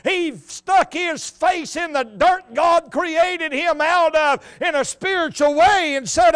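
high, loud, drawn-out pulpit cadence; full-force preaching. Real, not acted. Preacher tells how the thief's heart bowed, full-force preaching. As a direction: drive every phrase home at full voice.

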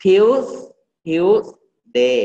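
"Tuesday" split after a drawn-out s; TUESSS... day; stressed S sound. Shown as a demonstration. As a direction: hold the S of "Tuesday", pause, then day. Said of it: "ออกเสียง S ให้ถูก".

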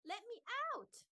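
high, loud trapped cry; scream. A narrator's reading. Buster can't find the tent flaps, high yell. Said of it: "screamed Buster".

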